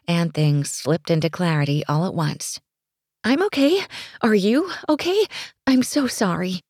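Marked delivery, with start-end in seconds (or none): 3.2-6.6 much higher, tumbling apologies; babbling, embarrassed, all in a rush; flustered rush. A narrator's reading. To the stranger she bumped into, flustered rushed apology. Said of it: "she said in a rush, completely flustered".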